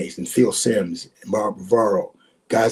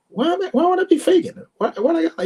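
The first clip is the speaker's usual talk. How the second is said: pitched way up in mock disbelief, a little louder; incredulous; 3 dB louder than usual. Telling the host how he answered a doubter, incredulous and high. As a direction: act baffled that anyone would ask. Retold reply to a doubting questioner.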